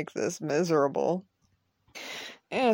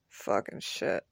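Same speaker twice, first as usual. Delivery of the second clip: breathy curse under the breath, much softer; whispered curse; 11 semitones lower, 10 dB quieter, slower than usual. Real, not acted. [name] curses under his breath about the misdiagnosis, whispered. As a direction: let it slip out under your breath.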